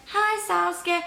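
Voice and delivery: high, sing-song mock hello; cheerful greeting. Villagers on boats greeting each other, high cheerful. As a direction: chirp it like a happy villager. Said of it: "they're all like".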